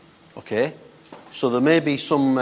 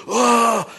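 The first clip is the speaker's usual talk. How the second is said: high, loud hammed-up battle cry; mock someone charging in over-dramatically; dramatic cry; 10 semitones higher, 4 dB louder than usual. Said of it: "rush across all dramatically".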